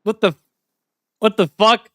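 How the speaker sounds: high, loud disbelief; shocked. Real, not acted. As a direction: caught totally off guard. Shocked by the new lyric.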